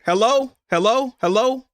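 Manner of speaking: calling into phone. Retold or imitated. Son answering a silent phone call, questioning. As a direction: check if anyone is on the line.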